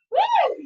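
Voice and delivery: high, loud, drawn woo; excited whoop. Real, not acted. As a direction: whoop as the finish nears. Cheering near the finish.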